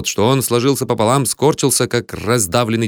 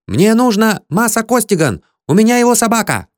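high, insistent clamour; raging shout; 11 semitones higher than usual. A narrator's reading. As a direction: clamour urgently to get through, pitched high. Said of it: "бушевал кто-то за дверью". (шумно и настойчиво требовать, высоким голосом)

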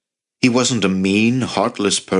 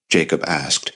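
ordinary delivery, nothing marked

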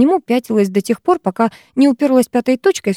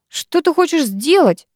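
slow, higher suspicious question; wary; 7 semitones higher, slower than usual. A narrator's reading. Girl eyeing classmate's glass, wary. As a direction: ask it on your guard. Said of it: "насторожилась я".

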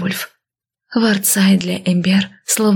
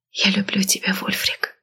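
soft breathy whisper of love; breathed; 6 dB quieter than usual. A narrator's reading. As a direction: breathe it into his neck. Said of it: "выдохнула ему в шею".

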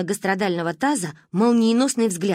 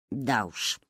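low, soft wry hmph; low dry grunt; 8 semitones lower, 7 dB quieter than usual. A narrator's reading. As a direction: grunt it out drily. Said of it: "хмыкнул папа".

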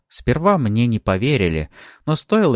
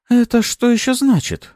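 high, raised surprised question; 5 dB louder than usual. A narrator's reading. Lady Sareeva questions the narrator, high-pitched surprise. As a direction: ask in indignant astonishment. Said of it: "удивлённо посмотрела на меня".

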